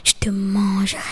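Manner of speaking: low, whispered threat; menacing whisper. Acted. Wolf threatening the miller, low menacing whisper. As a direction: breathe the threat, fangs bared. Le loup montre les crocs.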